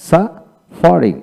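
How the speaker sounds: slow suffering, split after the first syllable; broken into syllables. Shown as a demonstration. Teacher taking learners through suffering, slow and broken up. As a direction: break suffering in two, slowly. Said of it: "এইভাবে ভেঙে ভেঙে".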